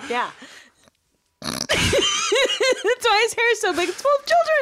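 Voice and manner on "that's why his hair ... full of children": high, laughing through words. Real, not acted. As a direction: giggle as you talk. Laughing at the parody.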